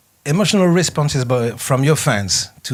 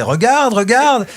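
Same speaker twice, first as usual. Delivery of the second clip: echoing the crowd; 10 semitones higher, 4 dB louder, slower than usual. Retold or imitated. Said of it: "elle répétait".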